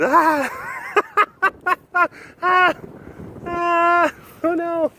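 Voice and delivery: very high mock squeals of panic; panicked fan. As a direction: imitate a fan shrieking and hiding. Replaying fan's panic behind rack.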